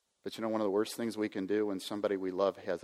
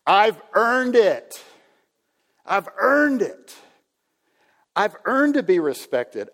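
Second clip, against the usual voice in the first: entitled whiner; 10 semitones higher, 10 dB louder than usual. Acted. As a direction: demand what you're owed like a spoiled grown-up. Pastor acting out entitled believer.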